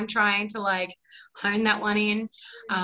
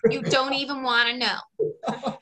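high, loud Brooklyn nail-salon voice easing slower; imitated Brooklyn accent, pitched up then slowing; 6 semitones higher, 3 dB louder than usual. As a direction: ham up a Brooklyn accent, drawing it out. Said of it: "really similar kind of accent".

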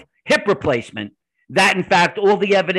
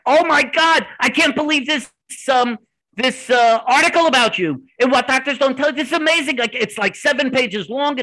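high-pitched imitation of an excited caller; retold breathless-with-news excitement; freaking out; 6 semitones higher than usual. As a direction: gush in a raised pitch, mimicking someone amazed. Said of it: "she's freaking out".

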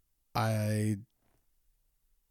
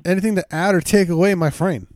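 higher and louder, pushing the question through; louder repeat; 5 semitones higher, 6 dB louder than usual. Real, not acted. Host re-asks co-host after interruption, louder. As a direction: raise it over the interruption.

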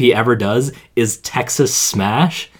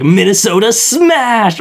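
higher, slow battle cry; anime attack call; 8 semitones higher, 3 dB louder, slower than usual. Retold or imitated. Imitating Deku calling his attack, a higher, slow mock battle cry. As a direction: call out the special move like a hero. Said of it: "he's like".

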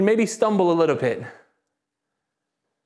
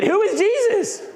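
high-pitched, drawn-out rallying shout; emphatic call; 11 semitones higher, slower than usual. Shown as a demonstration. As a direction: rouse the crowd, pitch way up and stretched. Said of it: "Say it like you believe it".

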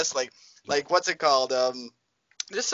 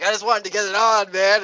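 wide-eyed wild card; 6 semitones higher, 4 dB louder than usual. Acted. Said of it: "his eyes are, like, wide open".

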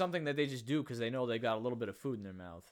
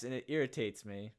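ordinary delivery

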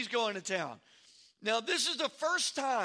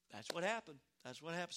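quiet, low-key mock agreement; murmured agreement; 3 semitones lower, 11 dB quieter, faster than usual. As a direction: murmur it like backers chiming in under their breath. Voicing nodding supporters behind attorney.

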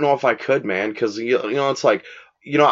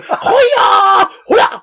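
high, loud battle cry; karate yell; 8 dB louder than usual. Retold or imitated. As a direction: yell like a martial arts strike. Repeats the kung fu cry.

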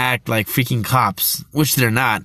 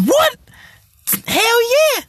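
excited; much slower than usual. Retold or imitated. Thrilled reaction to the filming news, excited shout. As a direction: burst out in delight. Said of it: "I was like".

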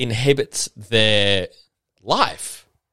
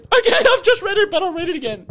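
much higher, laughing squeal; squeaky laughing reply; 4 dB louder than usual. Retold or imitated. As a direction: squeal helplessly while giving in.

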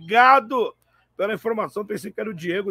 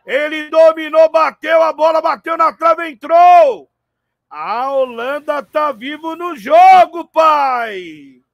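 screaming goal call at full power; explosive goal commentary; 7 semitones higher, 13 dB louder, slower than usual. Real, not acted. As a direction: erupt with joy, stretch the goal cry. Goal scored, commentator erupts. (narração explosiva de gol)

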